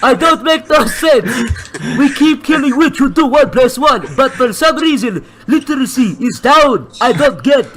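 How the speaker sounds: lightly voiced broken-English villager telling a tale; mock broken-English storyteller. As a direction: spin the witch story in a hammed-up foreign villager voice. Same villager character continues.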